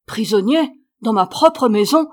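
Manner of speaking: loud, outraged protest; forceful, indignant outcry; indignant exclamation. A narrator's reading. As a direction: protest loudly at being locked in. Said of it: "se récria le bonhomme".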